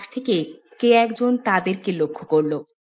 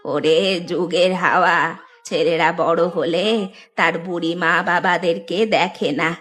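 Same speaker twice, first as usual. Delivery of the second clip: low, aged mother's lament; lamenting old woman; 4 semitones lower than usual. Acted. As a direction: lament abandoned old age in a granny voice. Old woman laments her sons. (বৃদ্ধার করুণ, নিচু গলা)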